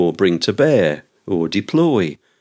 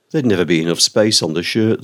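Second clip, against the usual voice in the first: ordinary talk, nothing marked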